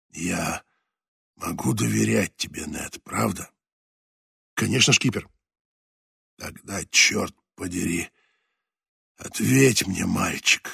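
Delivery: put-on low, soft, fading old sailor; dying skipper. A narrator's reading. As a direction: speak like a dying man holding on. Voices dying skipper Leclerc.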